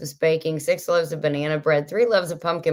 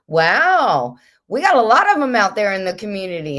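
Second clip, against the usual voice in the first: loud, fast, delighted exclamation; excited wow; 5 semitones higher, 6 dB louder than usual. Real, not acted. Host marvels at how many viewers own aprons, excited. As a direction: burst out with happy amazement.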